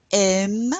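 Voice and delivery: "aiment" as one syllable, "em", the ENT silent; silent ENT ending. Shown as a demonstration. Teacher reading about students, "aiment" with silent ENT. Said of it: "We didn't pronounce that E N T".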